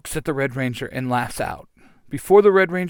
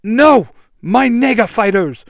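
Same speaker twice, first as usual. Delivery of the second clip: anguished villain cry; 8 semitones higher, 10 dB louder than usual. Acted. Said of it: "Korgon says".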